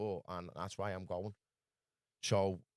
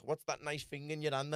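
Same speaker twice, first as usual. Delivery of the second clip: higher mock voice, sly question; a higher put-on voice eyeing the gadget; imitating the stranger; 6 semitones higher than usual. The stranger eyeing his charger pack, higher and mock-sly. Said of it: "And he's like".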